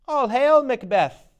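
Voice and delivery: high, loud witch cry of greeting; loud high prophetic hail. Acted. Second witch hails Macbeth.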